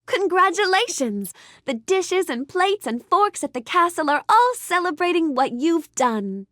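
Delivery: high, celebratory lilt; delighted congratulations. Acted. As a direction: congratulate them with real delight. Congratulates the guest on achievement.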